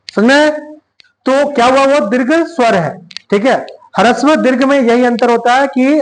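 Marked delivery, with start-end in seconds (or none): none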